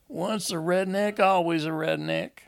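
high, sing-out taunt. A narrator's reading. Homer taunts Enoch at a wedding about his roots, high and mocking. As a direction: needle him with a high-pitched jeer. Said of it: "taunting Enoch about forsaking his roots".

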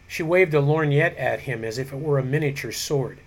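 ordinary delivery

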